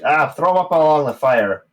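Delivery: high, loud gnome voice; gnome barkeep. Acted. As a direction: point them to the fire. Gnome directs.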